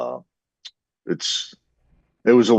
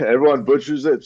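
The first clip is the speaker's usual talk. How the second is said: laughing; 7 semitones higher, 4 dB louder than usual. Real, not acted. Laughs about his name.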